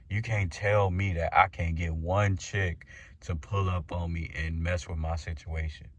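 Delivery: ordinary talk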